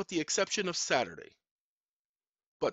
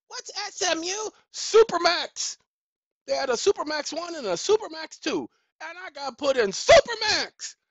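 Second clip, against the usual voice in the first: high, loud, exclaiming rant; agitated, high-pitched outcry; worked-up indignation; 11 semitones higher, 7 dB louder than usual. Real, not acted. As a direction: hammer it home in a raised voice. Worked-up telling of supermax placement.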